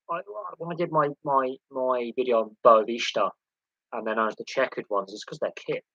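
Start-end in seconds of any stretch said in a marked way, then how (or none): none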